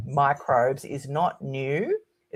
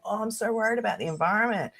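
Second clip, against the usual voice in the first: mock worry; 6 semitones higher, 5 dB quieter, faster than usual. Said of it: "everyone's like".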